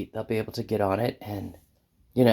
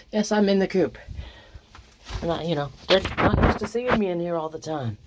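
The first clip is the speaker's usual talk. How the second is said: lightly raised pet talk; 8 semitones higher than usual. Real, not acted. Telling the hens why she's there, mildly raised pet voice. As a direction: explain kindly to the chickens.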